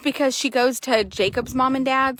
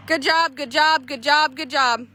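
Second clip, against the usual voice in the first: cheering praise; 4 semitones higher than usual. Real, not acted. Praising rider.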